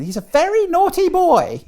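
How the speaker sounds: very high, loud hammed-up falsetto; shrill-free high loud comic falsetto; Monty Python falsetto. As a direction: shriek it like the Python mum. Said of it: "remind people of Monty Python".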